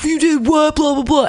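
high-pitched mocking blah-blah of students; mimicked complaining students. Teacher imitating students filtering back in, high mocking babble.

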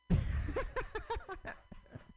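very high giggling burst; high-pitched laugh. Real, not acted. Comic laughing after his own joke, high giggle.

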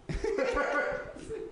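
high delighted laugh; high laughter burst. Real, not acted. Recalling Petey's remark, high laughter.

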